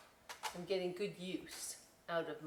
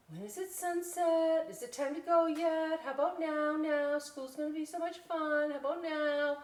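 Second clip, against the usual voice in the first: put-on high, eager kid voice; high impatient little bat voice; 8 semitones higher, 4 dB louder than usual. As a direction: pester like a child who can't wait. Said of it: "he's driving his mother bat crazy".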